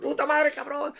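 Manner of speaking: put-on high little-boy curse; light kid voice. Retelling son's catchphrase, high kid voice. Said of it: "his little voice".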